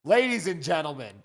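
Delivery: loud show host sign-off; announcer voice. Acted. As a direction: announce it to the crowd. Host's show outro.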